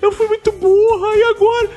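mock crying. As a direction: whine it like a crying friend. Imitando a amiga chorosa. (choro fingido agudo)

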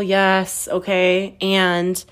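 usual speaking voice

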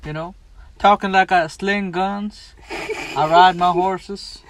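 put-on southern accent. Pitching a money-making act to his cohost, slow southern cowboy drawl. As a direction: play a gunslinging Southern cowboy, slow and proud. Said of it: "episode in a southern accent".

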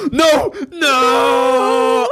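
high, loud cry of dismay; mock despairing shout; horrified no. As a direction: wail it as everything crumbles. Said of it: "And I was like".

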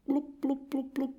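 tiny high, soft bloops; imitated bubble blips. Goldie swims on, ignoring Kat, small high bubbles. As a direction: make little fish bubbles with your mouth.

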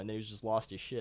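normal talking voice

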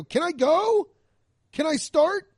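high, sarcastic pleading; sarcastic permission to start the set; mock pleading. Comic mocks asking heckler permission.

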